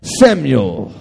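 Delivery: low, loud, booming divine call; God calls the sleeping boy, deep and loud; the Lord calling. Acted. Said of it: "called out again".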